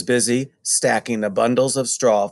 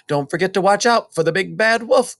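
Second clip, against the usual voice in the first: mother calling a warning, higher; mother calling goodbye, lightly raised; 8 semitones higher, 3 dB louder than usual. A narrator's reading. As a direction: call after them as they leave. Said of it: "called their mother".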